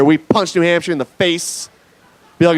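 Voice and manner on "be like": normal talking voice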